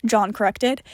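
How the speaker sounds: ordinary delivery, nothing marked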